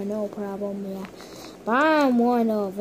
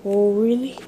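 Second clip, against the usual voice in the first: normal talking voice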